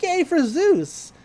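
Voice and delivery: high, drawn-out mock cheer; Mabel. Girl cheers on her friend's answer, high imitated excitement. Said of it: "Mabel's like".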